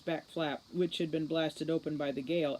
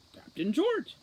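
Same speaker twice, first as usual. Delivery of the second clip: surprised; 9 semitones higher, 4 dB louder, slower than usual. Acted. Mulch surprised by officer.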